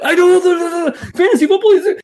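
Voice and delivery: high, loud, rushing retold excitement; going zero to ten thousand. Eagerly telling the coworker all about the player. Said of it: "I went zero to ten thousand immediately".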